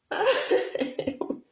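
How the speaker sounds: high airy burst of laughter; a sudden breathy laugh. Real, not acted. Streamer laughing at a comment, high breathy laugh.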